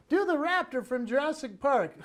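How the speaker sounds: put-on high-pitched child voice; eager little-kid request; excited kid's demand. Kids demanding the raptor.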